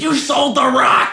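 high, slow hammed-up shout; Adam Sandler yelling in a serious drama; mock Sandler yell. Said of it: "when he's yelling at someone".

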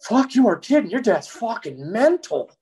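mock amazed cop; cop voiced in disbelief. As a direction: act out the shocked cop. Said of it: "she's like".

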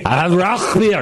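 Rauchbier with a huge throaty ch; hammed-up German Rauchbier order; exaggerated guttural German. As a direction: hawk the ch in Rauchbier, high-pitched. Joke of spitting guttural order.

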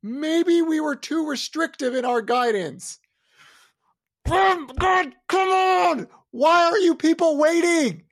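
high, loud drawn-out shouting; exasperated rant shouted at stalling colleagues; angry Waller. Retold or imitated. Imitating an angry Fed official berating colleagues, exasperated high shouting. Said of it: "Angry Waller, is like".

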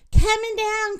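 high cry of warning; alarmed cry. A narrator's reading. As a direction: cry out as you start to drop. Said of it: "cried Minnie".

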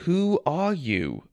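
slow, firm repeated question. A narrator's reading. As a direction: ask again, slowly and firmly. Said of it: "I repeated".

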